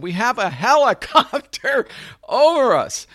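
high, loud yell over helicopter noise; exasperated retold shout. Director to actor under a helicopter, exasperated shouting. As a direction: shout it up to be heard overhead.

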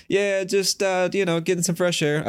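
high, strained casual voice; fake nonchalance. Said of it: "I said it like that".

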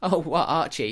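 ordinary talk

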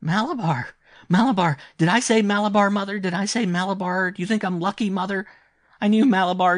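put-on high, loud, delirious boy's outburst; feverish excitement. A narrator's reading. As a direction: cry out in frantic, high-pitched triumph. Feverish boy's frantic triumphant outburst.

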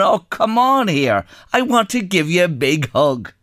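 hammed-up fake warmth with a big grin; overly friendly. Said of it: "With the biggest, friendliest smile".

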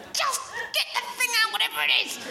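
high, strained mock outburst; exasperated outburst. Dorm sleeper to a bag rustler at night, exasperated.

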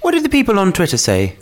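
usual speaking voice

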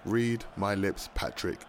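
slow menace. Acted. Villain threat to rival.

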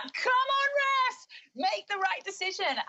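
high-pitched re-enacted shout at the referee; urging on the ref, voice leaping high; shouting at the screen. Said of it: "I was literally shouting at the screen".

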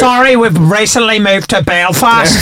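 high, slow Belfast apology; Northern Irish accent. Retold or imitated. Mock call-centre worker explaining the move, high Northern Irish accent. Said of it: "Not Northern Irish".

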